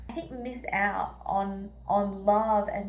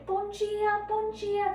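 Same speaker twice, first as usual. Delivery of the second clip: high, cheery Portuguese greeting; 11 semitones higher, slower than usual. Retold or imitated. Scene: a stranger on the street greeting her, high and cheery. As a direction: greet passers-by like old friends. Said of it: "they say".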